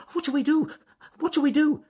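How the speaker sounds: very high, loud excited rabbit squeal; excitedly. Acted. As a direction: squeal it in a flap. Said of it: "said Ernest Fluffy Rabbit excitedly".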